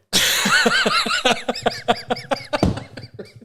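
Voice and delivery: a burst of high-pitched laughter. Real, not acted. Telling a mate a story, high-pitched laughter. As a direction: laugh high and long at your own joke.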